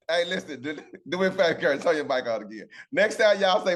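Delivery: ordinary talk, nothing marked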